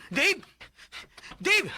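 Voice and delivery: high, loud panicked call; frantic yell. Acted. Mother yelling for Dave in panic, high frantic call. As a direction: scream the boy's name in alarm.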